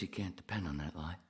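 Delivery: ordinary talk, nothing marked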